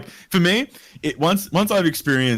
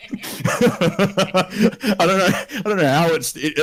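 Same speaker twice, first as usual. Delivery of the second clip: slow, high, laughing; 7 semitones higher, much slower than usual. Real, not acted. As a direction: stretch the words out while still laughing. Laughing after the Google interruption.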